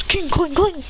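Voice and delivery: high metallic "cling" noises; imitated clinking. Sasuke fighting back, imitated high clinks.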